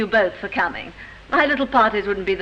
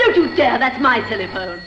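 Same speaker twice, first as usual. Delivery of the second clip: sharp, higher and louder: don't you dare; angry outburst; 4 semitones higher, 4 dB louder than usual. Acted. Viv shouts at the inspector not to touch her phone, angry. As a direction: cut him off furiously.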